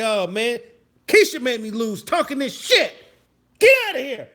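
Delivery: high, loud complaint rising to a yell; mock-angry arcade outburst; acted sore loser. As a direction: whine about losing, then shout them away. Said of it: "you can say".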